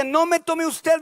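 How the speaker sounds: high, loud protest; anguished plea. Acted. Ana to the priest, loud high plea. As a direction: plead not to be misjudged. Acts Ana's plea to Eli.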